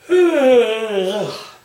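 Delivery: loud exasperated sigh; sighing at a dull match; heavy sigh. Real, not acted. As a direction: let out a big fed-up sigh. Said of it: "That's what I thought of that match".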